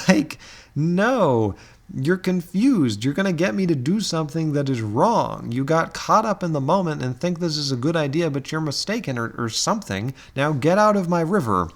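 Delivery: hammed-up exasperated dismissal; facetious exasperation. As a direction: put on an affronted refusal for comic effect. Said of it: "that's being facetious".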